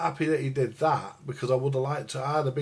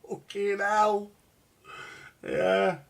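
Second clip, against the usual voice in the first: laughing through the pain, slow and higher; real laugh that hurts, then a slow curse; breaking into a painful laugh; 8 semitones higher, much slower than usual. Real, not acted. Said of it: "I'm trying not to laugh because when I do, it hurts".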